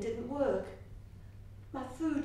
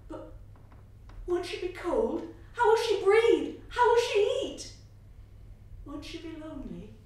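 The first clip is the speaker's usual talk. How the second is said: put-on frightened child; 7 semitones higher, 10 dB louder than usual. A narrator's reading. Child fears for buried mother.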